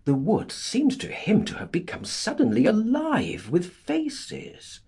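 ordinary delivery

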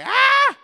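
very high, loud exasperated cry; frustrated scream. Pretend. Voicing a tired retreat youth at the end of their rope, high loud scream. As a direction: let out all the overwhelm in one yell. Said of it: "tal vez estás como que".

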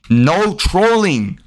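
"No trolling." stretched very slowly, hit hard; slow emphatic. Shown as a demonstration. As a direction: hammer the rule home at a crawl. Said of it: "It says, "No trolling today".

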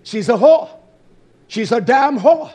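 loud, high condemning; angry denunciation. Real, not acted. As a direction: spit out the condemnation.

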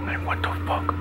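hushed, frightened whisper; a terrified whisper in the dark house; scared whisper. Acted. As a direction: whisper it so nothing hears you. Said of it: "I'm scared as hell".

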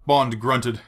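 ordinary delivery

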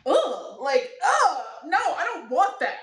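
squirming "ugh, no"; disgusted. Retold or imitated. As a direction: recoil from the idea in disgust. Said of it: "I was like".

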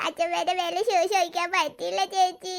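put-on slow, sorrowful baby talk; tearful little boy. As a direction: whine out the sad reason slowly. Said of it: "നീ എന്തിനാ കരയുന്നേ". (സങ്കടത്തോടെ കരയുന്ന കുഞ്ഞ്)

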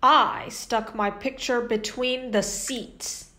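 usual speaking voice

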